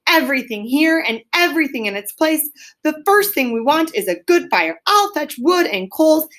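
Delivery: cheerfully bossy, getting to work; cheerful call. A narrator's reading. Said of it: "He called out cheerily".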